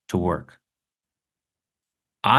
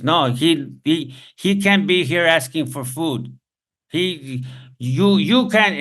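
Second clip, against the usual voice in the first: high-pitched, insistent retold scolding; objecting Mexican woman; 8 semitones higher, 3 dB louder, slower than usual. Mexican woman at the sandwich place refusing a beggar, high insistent objection. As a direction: imitate her protest, pitched well up. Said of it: "a Mexican woman there at this place says".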